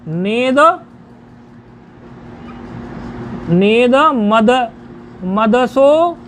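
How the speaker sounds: नेद, नेद मद, मदसो built up slowly piece by piece; pronunciation drill. Shown as a demonstration. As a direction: stretch नेद and मदसो for learners to copy. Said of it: "क्या है उच्चारण?".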